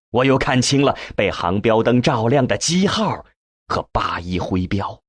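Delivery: usual speaking voice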